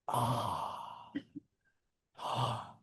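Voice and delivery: hushed gasp of realization. Retold or imitated. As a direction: let the ah slip out in awe. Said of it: "Could you tell the difference between the two ahs".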